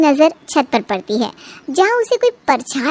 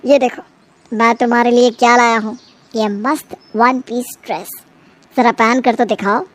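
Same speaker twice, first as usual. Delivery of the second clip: lower, coaxing husband; husband voiced lower, lightly; 6 semitones lower than usual. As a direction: sweet-talk your wife into it. Husband voiced by female narrator.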